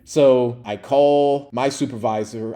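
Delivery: ordinary talk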